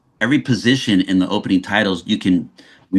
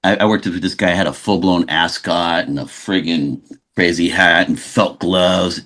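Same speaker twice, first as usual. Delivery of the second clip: usual speaking voice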